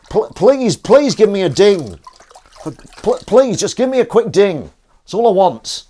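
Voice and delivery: loud, high-pitched begging; stammered, high, loud plea; mock pleading. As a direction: beg desperately, hammed up.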